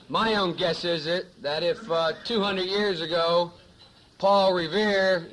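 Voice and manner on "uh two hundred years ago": ordinary talk, nothing marked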